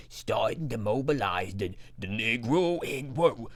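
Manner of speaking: a hint of a Russian accent, reading the quote; reading a communist paper in a faltering Russian accent; lightly done Russian accent. Reader tries a lightly voiced Russian accent on the Chicago quote. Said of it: "I'm gonna put on the Russian accent first".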